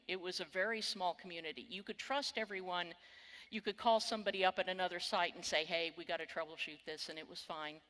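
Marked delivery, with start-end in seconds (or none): none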